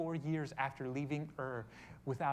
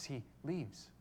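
ordinary delivery

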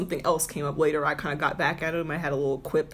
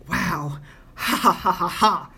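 louder put-on ha ha ha; hammed-up gloating laugh; mocking laughter; 4 dB louder, slower than usual. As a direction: imitate his knee-slapping taunting laugh. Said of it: "literally slapping his knee".

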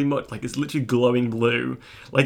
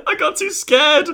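squeaky scared voice; 14 semitones higher, 5 dB louder than usual. Retold or imitated. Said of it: "She's like".

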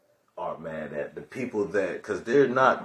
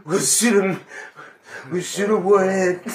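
mock Trump shout; 8 semitones higher, 5 dB louder than usual. Trump quoted.

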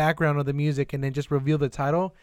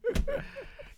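high squealing burst of laughter; shrieking laugh; 8 dB quieter than usual. Real, not acted.

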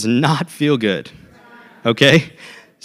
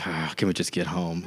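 weary groan; 10 semitones lower, 13 dB quieter than usual. Retold or imitated. Tired parents after Christmas lights.

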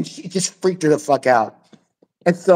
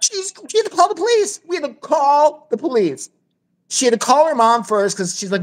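usual speaking voice

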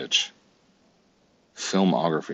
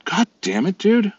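frustrated; 6 dB louder than usual. Real, not acted. Losing patience with the app.

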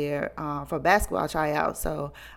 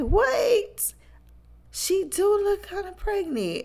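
friend's words pitched way higher, drawn out in dawning realization; mock high-voiced friend; 14 semitones higher, slower than usual. Friend telling the story's secret realizes, high voice. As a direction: play the friend catching on, voice up high.

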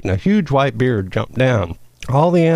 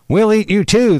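higher-pitched storybook snarl; snarled threat; 9 semitones higher, 4 dB louder than usual. A narrator's reading. Lead weasel threatens his prey, snarling. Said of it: "the lead weasel snarled".

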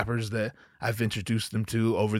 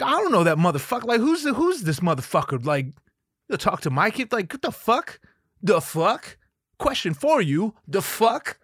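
higher, fast indignant outburst; indignant rant; 7 semitones higher than usual. Retold or imitated. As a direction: huff at the guy who talked to your kid. Defending himself indignantly.

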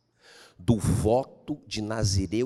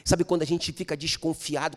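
usual speaking voice

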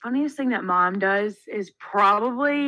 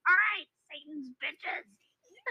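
high, slow, softer mock-mom voice; imitating exasperated mom; 4 semitones higher, 5 dB quieter, much slower than usual. Daughter imitating mom scolding girls in pool, mock exasperation. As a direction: draw it out as a put-on threat. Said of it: "her underbite would show".

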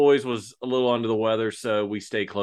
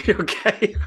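high-pitched laughing okay; 11 semitones higher than usual. Real, not acted. Co-host amused by the bold praise, laughing. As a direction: laugh through the word. Amused reply to co-host's boast.

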